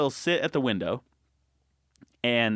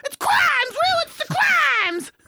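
loud falsetto shriek, drawn out; high-pitched frenzy; 5 dB louder, slower than usual. Shown as a demonstration. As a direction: scream it sky-high to wind up the dog. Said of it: "the higher pitch my voice gets".

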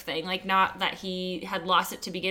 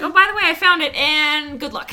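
laughing; 7 semitones higher, 10 dB louder than usual. Retold or imitated.

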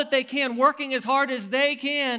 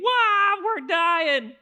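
much higher, louder mock panic cry; panicked yelling; 6 semitones higher, 5 dB louder than usual. Preacher voicing terrified fishermen screaming in the storm, high mock yell. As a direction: shout it like men sure they'll drown. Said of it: "these guys yelling, screaming".

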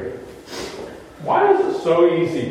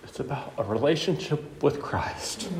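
choked up; 6 semitones lower, 12 dB quieter than usual. Real, not acted. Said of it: "I'm gonna stop crying".

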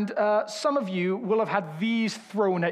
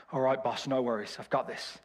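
put-on Birmingham accent; 7 semitones lower, 4 dB quieter than usual. [name] answering his boss in a Birmingham accent. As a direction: lay on the Birmingham accent, easygoing. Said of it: "he sounds a little like [name]".

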